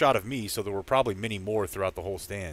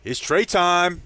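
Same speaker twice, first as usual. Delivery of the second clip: loud, higher hype shout; imitated fan yell; 7 semitones higher, 7 dB louder, much slower than usual. Imitating fans as Trey Lance comes in, loud cheering yell. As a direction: holler it like a fan in the stands. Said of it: "three or four people I heard yelling".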